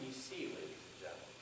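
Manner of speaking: soft, understated address to the audience; toned down. Shown as a demonstration. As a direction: ease gently into "ladies and gentlemen". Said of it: "it actually tones me down".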